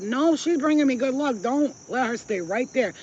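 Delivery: loud, high gleeful boast; excited gloating. Retold or imitated. To the dealer at the poker table, loud gleeful refusal. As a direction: crow about the lucky streak out loud. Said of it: "I said".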